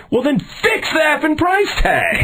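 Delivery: very high-pitched furious demand; acted irate yell; exasperated outburst. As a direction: explode at the absurdity.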